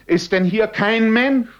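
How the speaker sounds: very high, loud cry of despair; anguished cry. A narrator's reading. Man alone in the mist, crying out desperately. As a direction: cry out for a human soul. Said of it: "schrie er wieder".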